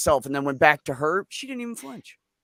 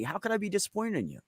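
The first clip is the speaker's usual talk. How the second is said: gentle reassurance; 6 semitones higher, 8 dB quieter, faster than usual. Retold or imitated. Parent reassuring child, gently.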